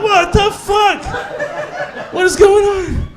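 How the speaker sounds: high, stretched-out "What the fuck?"; bewildered squeal; baffled shriek. Retold or imitated. Baffled by the sound.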